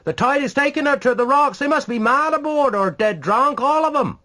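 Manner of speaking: high, loud urgent shout; alarmed shout. A narrator's reading. Bystander shouting that the ship is doomed, alarmed. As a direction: yell the warning over the storm. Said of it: "cried a voice".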